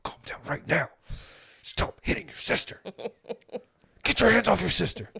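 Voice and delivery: harsh whispered scolding rising to a yell. Retold or imitated. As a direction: scold in a hissed whisper, then break loud. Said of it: "I mastered the art of whisper yelling".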